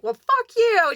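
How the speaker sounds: high, loud acted outrage; offended retort. Mean friend answers the ones laughing at her, high offended retort. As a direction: throw back a mock-furious high retort.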